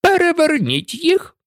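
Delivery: high-pitched cross command; irate royal command; angry queen's order. A narrator's reading. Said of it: "Королева сердито відвернулася".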